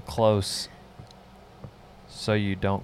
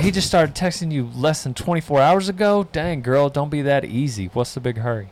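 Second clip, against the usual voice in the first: mock scolding; 5 semitones higher, 4 dB louder than usual. Acting out scolding advice to texter.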